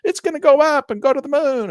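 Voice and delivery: mock trader, loud and high, dreaming of the moon; regretful retail trader. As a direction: ham up a trader kicking himself. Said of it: "it gets all the retail traders to say".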